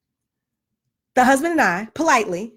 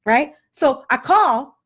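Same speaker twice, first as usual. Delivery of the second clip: usual speaking voice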